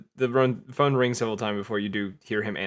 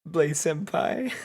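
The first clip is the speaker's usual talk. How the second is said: put-on slow, higher anime girl coo; 5 semitones higher, much slower than usual. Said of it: "pictured it like an anime girl".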